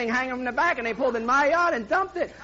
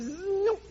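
high, quick zoop; playful sound of a saw jamming; mock chainsaw zoop; 7 semitones higher than usual. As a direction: make the sound of the blade sinking in. Chainsaw biting into the wood.

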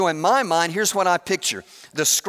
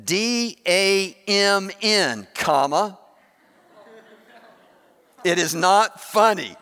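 spelling out; 2 semitones higher, slower than usual. Retold or imitated. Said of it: "and it said".

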